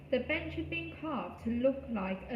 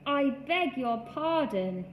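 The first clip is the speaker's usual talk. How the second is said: deep growly voice; 4 semitones higher, 4 dB louder, slower than usual. A narrator's reading. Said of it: "in a deep, growly voice".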